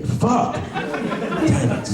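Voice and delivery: high-pitched burst of frustration; mock frustrated cry. Man thwarted by leg day curses, high and frustrated. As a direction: curse in a hammed-up squeal. Acted frustration at failed plan.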